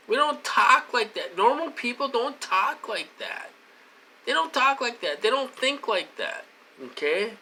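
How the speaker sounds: outraged rant. Real, not acted. Ranting to viewers that normal people don't talk like that, outraged. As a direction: drive it home with mounting outrage.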